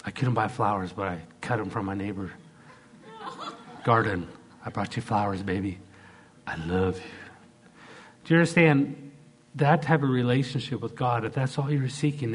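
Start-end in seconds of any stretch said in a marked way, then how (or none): 4.7-7.1 low, soft crooning husband; mock romantic wooing. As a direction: sweet-talk the wife, dropping lower to the end. Husband wooing wife in skit.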